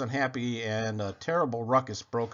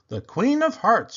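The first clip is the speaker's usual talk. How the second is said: loud start; 10 semitones higher, 8 dB louder than usual. Acted. Rhymes in chaos.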